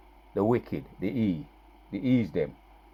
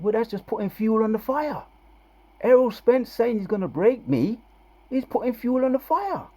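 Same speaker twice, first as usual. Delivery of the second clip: quoting the trainer; 12 semitones higher than usual. Retold or imitated. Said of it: "He said".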